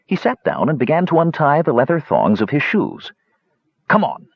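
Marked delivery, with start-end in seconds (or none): 3.9-4.3 high-pitched urging; pressing. A narrator's reading. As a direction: press your companion to hurry. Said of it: "he pressed".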